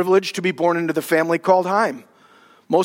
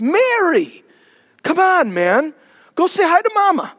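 mock calling out; 10 semitones higher than usual. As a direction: call out to him with mock urgency, up high.

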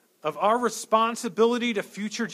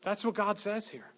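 ordinary delivery

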